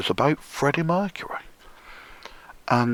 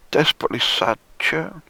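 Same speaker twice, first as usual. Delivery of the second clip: ordinary delivery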